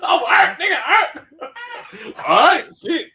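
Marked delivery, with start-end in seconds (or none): 0.0-1.3 whining hurt friend. Retold or imitated. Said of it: "I was like".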